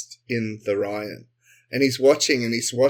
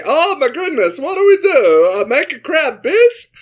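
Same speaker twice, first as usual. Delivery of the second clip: high, loud panicked shrieking; a cook screaming in panic while pulling out knives; 14 semitones higher, 9 dB louder than usual. Acted. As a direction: shriek it like a terrified cook in a crisis. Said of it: "just screaming".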